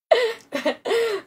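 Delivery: a short high burst of giggling; high giggle. Real, not acted. Laughs at own complaint.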